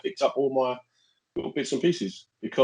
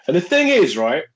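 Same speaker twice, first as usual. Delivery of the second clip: ordinary delivery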